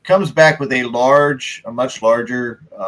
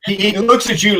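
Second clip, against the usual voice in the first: usual speaking voice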